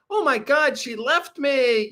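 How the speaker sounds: mock the dumped guy; hammed-up heartbroken whine; mock whiny guy. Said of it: "it's like".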